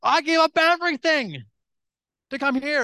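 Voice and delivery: high, loud plea to God; hammed-up anguished prayer; retold tearful plea. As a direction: act out crying out in protest. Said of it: "on my knees crying".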